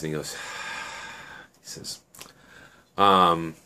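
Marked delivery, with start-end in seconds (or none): 0.4-1.0 long, soft, breathy sigh; acted sigh. Shen reacts to Annie's request for help, sighing. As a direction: let out a quiet, drawn-out sigh. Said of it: "Shen kinda sighs".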